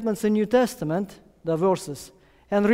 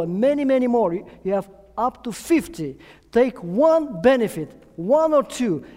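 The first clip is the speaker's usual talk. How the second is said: louder and higher, pressing the point; rising preaching call; 6 semitones higher, 4 dB louder than usual. Real, not acted. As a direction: lift the voice to rally the congregation. Preacher urging congregation to act.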